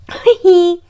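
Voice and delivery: loud high laugh; high burst of laughter. Real, not acted. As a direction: let out a high cackle. Laughing at royals' exit.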